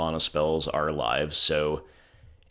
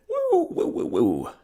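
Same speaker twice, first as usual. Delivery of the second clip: excited whoop; slower than usual. Real, not acted. Celebrating a lucky draw.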